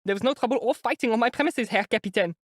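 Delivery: put-on high, rattling German accent; strong German accent. A narrator's reading. Landlady defending herself to the lieutenant in German accent. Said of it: "in a strong German accent".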